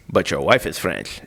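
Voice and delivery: low, soft, knowing reassurance; French officials. Retold or imitated. French officials reassure worried husband about fake ID, knowing. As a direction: imitate an official slyly countering, voice dropped. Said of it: "And they're like".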